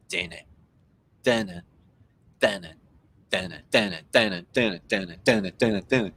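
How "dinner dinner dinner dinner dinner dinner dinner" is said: usual speaking voice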